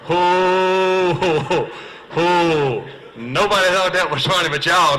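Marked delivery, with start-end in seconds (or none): 0.0-2.9 loud, high booming ho ho laugh; belly-laugh at your own punchline; hearty ho-ho laugh. Real, not acted. Laughing loudly at his own airport joke, booming ho ho.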